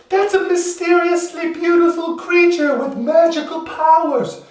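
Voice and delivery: very high falsetto wonder; awed villagers. Acted. Villagers marvel at the newborn unicorn, squeaky and awed. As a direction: squeal it in amazement. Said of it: "villagers are all like".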